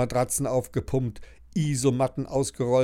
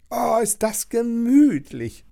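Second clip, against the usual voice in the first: higher, louder, slow happy sigh; delighted cry; 12 semitones higher, 6 dB louder than usual. Acted. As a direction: sink into a cosy bed with joy. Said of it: "ruft sie und kuschelt sich zurecht".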